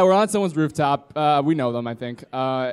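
normal talking voice

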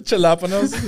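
"Jalapenos" as "chuh-LAP-uh-nohs", loud and high; gringo mispronunciation. Shown as a demonstration. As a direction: echo "Jalapenos" with a hard English J. Echoes deliberate mispronunciation.

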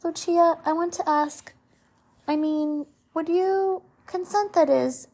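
high, halting, hesitant proposal; nervous, trembling suitor. A narrator's reading. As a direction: stall and stretch the words as courage fails. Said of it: "Philip trembled a bit".

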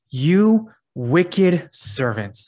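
very slow, YOU, WICKED, SERVANT each weighted; emphatic rebuke. A narrator's reading. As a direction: stretch and raise YOU, then land hard on "wicked servant". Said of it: "emphasizing these words".

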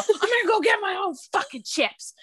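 high, mock yell on the way out; yelling at the family before storming off; retold yell. Pregnant wife yelling at husband she'll buy her own chips, high mock yell. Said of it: "I yell".

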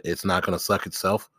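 low, straight-faced delivery; deadpan retold line. Man telling friends what he has said to women, deadpan. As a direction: say it dead serious, without a wink. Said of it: "looked dead in the face and said".

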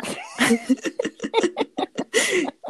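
nervous laugh. Real, not acted. Laughing while retelling nervous moment.

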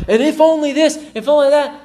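high, loud mock whine; hammed-up high whining; mock whiny fretting. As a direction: whine it like a worked-up worrier. Pastor mocks his own fretting.

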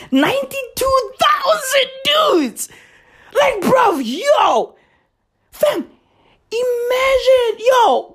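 high-pitched shouting in shocked outrage; outraged disbelief. Acted. Ranting to the audience about the Boy Scouts news, high shocked shouting. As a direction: yell it up high like you can't believe the news.

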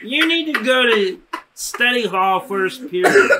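slow, high froggy counselor voice; Kermit the Frog. Retold or imitated. Counselor correcting the kid, slow froggy voice. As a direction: spell it out like a patient puppet frog. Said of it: "This guy sounded like Kermit the Frog".

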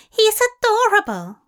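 high, loud, delighted coo; cooing Tracy. A narrator's reading. Tracy cooing over Crowley, high and delighted. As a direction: gush over him up high.

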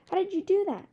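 put-on a startled girl hearing a voice in her head. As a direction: jump back in alarm.

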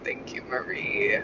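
soft, slow whispered thank-you. Real, not acted. Podcaster quietly thanking her advisor again, whispered. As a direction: breathe the thanks under your breath.

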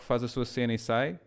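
ordinary delivery

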